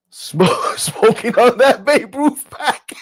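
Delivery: high, loud and slow through laughter; laughing with excitement at the news; excited laughing. Real, not acted. Streamer cheering the news, laughing loud and high. Hyped over Mets news.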